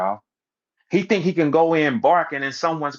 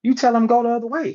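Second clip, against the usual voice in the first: lightly voiced, higher protective brother; a hint of a caring big brother; 10 semitones higher than usual. Lightly voiced big brother telling his baby brother to go the other way, higher. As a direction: steer the little brother away from danger. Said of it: "He sacrifices his little brother".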